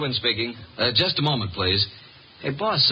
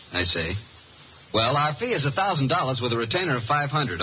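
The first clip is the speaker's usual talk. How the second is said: ordinary delivery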